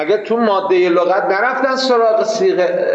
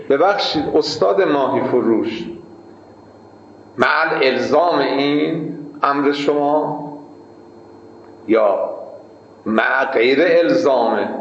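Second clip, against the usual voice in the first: lower, slow, mock-scholarly question; mock pedantic apprentice; 5 semitones lower than usual. The apprentice asking the fishmonger, in a low slow mock-learned voice. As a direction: play the fussy apprentice quizzing his boss.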